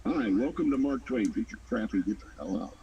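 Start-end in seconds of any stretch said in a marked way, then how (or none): none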